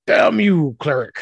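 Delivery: loud, high cry of playful outrage; hammed-up exasperated shout; mock curse. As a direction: curse them like a thwarted villain. Game master curses the cleric in play.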